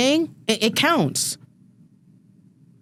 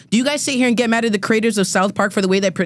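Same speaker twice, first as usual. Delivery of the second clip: ordinary talk, nothing marked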